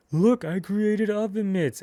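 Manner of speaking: high, drawn-out mock boast; high-pitched put-on voice, stretched out; showing off. Acting out character showing off creation.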